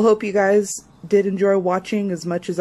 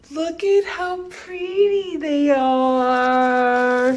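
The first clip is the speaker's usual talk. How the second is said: 1 soft cooing; 2 semitones higher, 7 dB quieter, slower than usual. Real, not acted. Cooing over roses.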